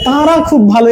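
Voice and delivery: "তারা" overdone through the nose in "তারা খুব ভালো"; exaggerated chandrabindu. Shown as a demonstration. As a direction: say "তারা খুব ভালো" with a heavily nasalised first vowel. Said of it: "এরকম তো উচ্চারণ করি না".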